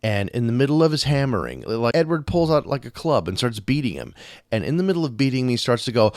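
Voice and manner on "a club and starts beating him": normal talking voice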